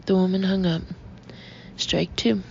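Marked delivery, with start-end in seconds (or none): none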